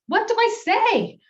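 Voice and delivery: high, loud, slow panicked plea; panicky question. Retold or imitated. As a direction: beg your coworkers for help, frantic. Nervous before big show.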